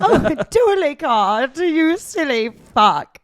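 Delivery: high, slow posh queen voice. Pretend. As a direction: play the queen, prim and shrill. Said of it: "So the queen would be like".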